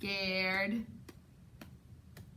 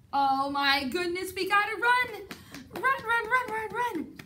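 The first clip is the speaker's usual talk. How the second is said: panicked alarm; 8 semitones higher than usual. Acted. Reader playfully panics as the bear chases them home. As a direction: cry out like the bear is right behind you.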